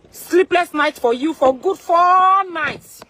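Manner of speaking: yelling a threat at a rival; angry shout. Acted. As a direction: bellow the curse out slowly.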